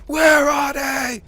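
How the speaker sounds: mock robber yell. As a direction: bark it like a cartoon stick-up. Mock stick-up yelling at staff.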